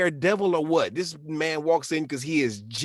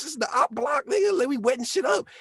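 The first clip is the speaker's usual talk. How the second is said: much higher, hyped-up gangster voice; reckless Floch. Retold or imitated. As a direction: squeal it out like a wild hothead. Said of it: "He's like".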